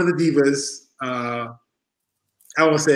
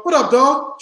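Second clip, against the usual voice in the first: hyped greeting; 10 semitones higher, 4 dB louder, slower than usual. Retold or imitated. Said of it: "I go".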